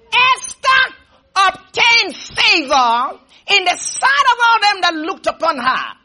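slow, emphatic scripture declamation starting high; full-force preaching. Real, not acted. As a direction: proclaim the verse, stretching key words.

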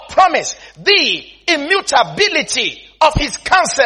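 slow, emphatic preacher's reading; scripture declamation. Acted. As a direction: declaim each phrase of scripture with weight. Reads scripture aloud with relish.

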